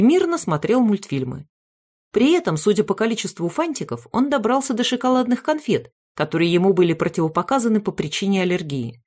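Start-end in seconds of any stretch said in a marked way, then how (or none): none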